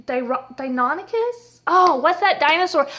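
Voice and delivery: ordinary talk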